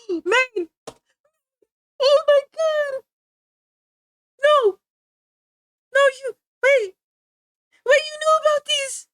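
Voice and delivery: high, loud squeals of disbelief; thrilled shock. Real, not acted. Finds fans who know her clips.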